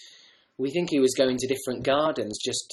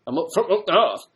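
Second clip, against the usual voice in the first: put-on broad, higher, louder northern English accent; 6 semitones higher, 6 dB louder than usual. Actor showing off at an audition, broad northern accent. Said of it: "do a northern accent".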